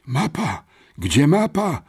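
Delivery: high, agitated outburst; frantic babble. A narrator's reading. Freed boy desperately asking for his map, frantic. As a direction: blurt it out in rising alarm. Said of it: "wybełkotał".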